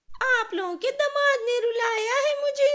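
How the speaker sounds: very high, loud sobbing complaint; loud crying. Acted. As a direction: wail it out through tears. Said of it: "जोर-जोर से रोने लगती है".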